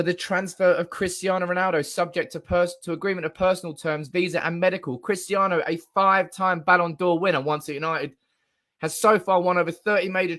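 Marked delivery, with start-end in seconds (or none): none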